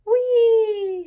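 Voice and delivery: very high, loud gleeful "whee"; gleeful shout. Acted. Goldie rocks in little Chan's chair, shouting with glee. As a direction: shout with delight as you rock. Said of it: "she shouted".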